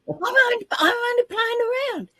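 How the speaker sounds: high whiny wail with a crying catch; mock whimper. Protesting to nobody that she was only playing, mock whimper. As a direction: plead like a caught kid, half-crying. Panicked protest after going live.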